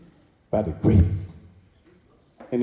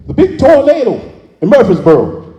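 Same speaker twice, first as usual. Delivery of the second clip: mock news alarm; 10 semitones higher, 8 dB louder than usual. Mock newsflash announcement.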